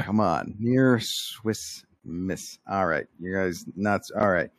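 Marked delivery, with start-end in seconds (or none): 0.6-2.6 "Near Swiss Miss" drawn out very slowly; slowly spelled-out pun. Real, not acted. Comic repeats a failed pun for the hosts, very slowly. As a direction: separate "Near", "Swiss" and "Miss" with long gaps.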